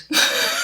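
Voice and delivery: short, very high giggle; real squeaky laugh at your own fib. Real, not acted. Laughs at her own lie.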